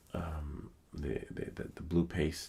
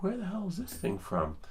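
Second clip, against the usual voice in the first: baffled; 13 semitones higher than usual. Retold or imitated. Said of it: "I was like".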